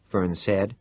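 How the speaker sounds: ordinary delivery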